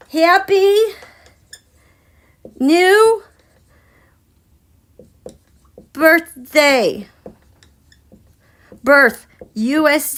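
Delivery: loud, word by word with long pauses; slow dictation. Real, not acted. Host dictates a birthday wish for paper and pencil, slow loud dictation. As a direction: dictate it loudly, leaving gaps to write.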